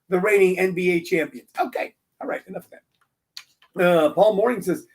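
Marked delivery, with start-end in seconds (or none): 1.6-3.8 hushed aside. Real, not acted. Pulls himself together, whisper.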